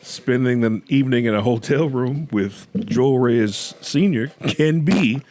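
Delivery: slow, announcer-style warning with a laugh; hammed-up slow warning voice; mock warning announcement. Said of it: "mock warning announcement".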